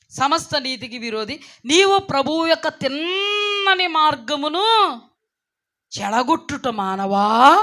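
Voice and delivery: very loud, drawn-out denunciation; slow thundering declamation; fiery rebuke. Acted. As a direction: build to a roaring, stretched challenge. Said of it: "ఏమని మాట్లాడుతున్నారో ఇక్కడ".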